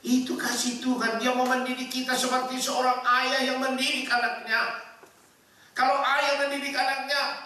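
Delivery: high-pitched pulpit delivery; full-force preaching. Real, not acted. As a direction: preach it out in rising, ringing phrases.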